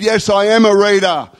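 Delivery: high, drawn-up call back; retold shouted reply. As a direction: act out a neighbour calling down. Neighbour calling back down, retold.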